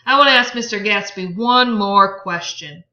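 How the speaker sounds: insist, hard and loud; insistent Tom. Acted.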